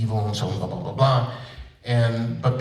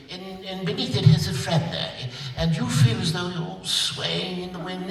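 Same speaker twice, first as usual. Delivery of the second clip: lightly voiced fussy director, rising higher; mock director; 6 semitones higher than usual. Voice actor mimics a director giving notes, lightly mocking. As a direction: imitate a rambling arty director. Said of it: "If a director says".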